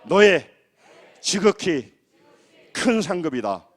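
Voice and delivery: loud phrase-by-phrase prompting with pauses; call-and-repeat. Shown as a demonstration. Pastor has congregation repeat God's promise, loud call-and-repeat. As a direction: call out each phrase for them to echo. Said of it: "따라합니다".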